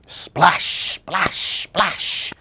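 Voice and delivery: each splash punched with a long hiss; splashing sound words. A narrator's reading. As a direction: make each word sound like water hitting. Imitating presents hitting water.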